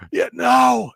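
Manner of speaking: high, loud retold cry of refusal; imitated scream. Frightened player answering are you all right, imitated scream. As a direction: mimic a panicked player screaming. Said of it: "like, screaming".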